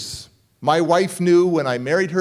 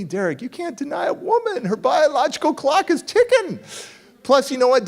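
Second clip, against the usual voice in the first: raised, higher pitched buddy; higher mock buddy voice; friend's voice; 10 semitones higher than usual. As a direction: play the know-it-all friend, pitched up.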